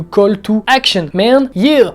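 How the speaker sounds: put-on drawn-out, show-off American English accent; hammed-up English accent. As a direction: play up an English accent like you're from San Francisco. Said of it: "J'adore l'accent anglais. Putain, mon accent anglais".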